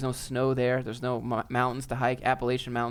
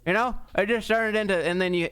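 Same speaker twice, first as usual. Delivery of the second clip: high mock Southern twang thickening into a garble; increasingly country accent; 7 semitones higher than usual. Mocking talk further out from Atlanta, thick country accent. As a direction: get more and more country, pitched way up. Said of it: "a little bit more and more country".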